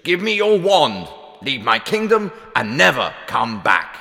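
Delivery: high outburst, dropping toward the end; furious, commanding king; raging king. A narrator's reading. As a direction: banish her in a royal rage. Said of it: "King Lear raged".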